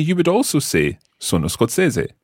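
ordinary talk

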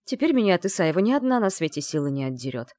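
usual speaking voice

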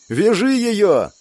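high, drawn-out command; barked order. A narrator's reading. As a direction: bark it out as an urgent order. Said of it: "гаркнула Герда".

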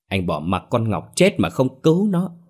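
indignation rising to a high, loud cry; angry accusation. A narrator's reading. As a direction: build to an outraged shout at the end. Angry friend accuses the man. (giận dữ chất vấn)

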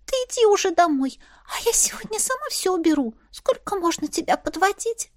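high breathy whisper. A narrator's reading. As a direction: whisper it, not squeaking. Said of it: "прошептала, не рискуя пищать".

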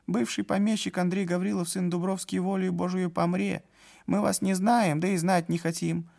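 high, sneering clerk voice; insolent clerk. A narrator's reading. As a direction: dismiss them with contempt. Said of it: "с дерзким взором".

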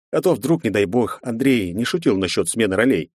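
ordinary delivery

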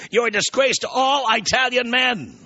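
high-pitched indignant denouncement; acted outrage. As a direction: denounce him in hammed-up indignation. Acted outrage while reading insult.

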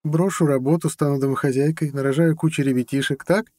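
high, teasing woman's voice; open irony. A narrator's reading. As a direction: list it off with mock-questioning irony. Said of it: "с неприкрытой иронией поинтересовалась". (с неприкрытой иронией, высоко)

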